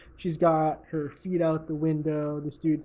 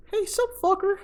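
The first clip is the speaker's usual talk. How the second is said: high-pitched mock bro voice, tossing out a sneer; squeaky, smug jab at a rival; cocky rival greeting; 5 dB louder than usual. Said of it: "he's all like".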